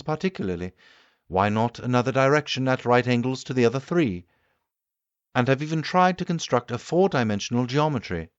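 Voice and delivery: the keen inventor holding forth to his guests; the Time Traveller lecturing. Acted. Time traveller explains his theory.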